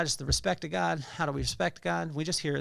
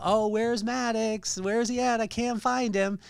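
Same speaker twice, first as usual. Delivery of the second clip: raised, higher pretend-searching call; playful yelling out; 6 semitones higher, slower than usual. Dad plays hide-and-seek with his son, yelling out in pretend puzzlement. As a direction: call out as if you can't find the child. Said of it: "And then I would yell out".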